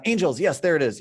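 excited affirmation. Real, not acted. Student gives the awaited answer.

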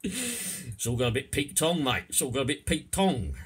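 high, loud Cockney character; Cockney accent. Shown as a demonstration. Man telling his mate things went wrong, loud Cockney. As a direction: call it out as a matey Cockney. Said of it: "I'll do the first bit in Cockney".

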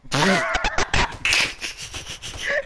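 airy rising laugh; wheezy laughter; breathy laugh. Real, not acted. Laughing at the fail.

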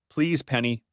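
ordinary delivery, nothing marked

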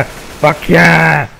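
ordinary delivery

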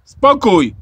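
loud, high teacher's command; stern shout for order. A narrator's reading. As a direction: shout down a noisy table. Teacher silences noisy pupils. (głośny, surowy rozkaz)

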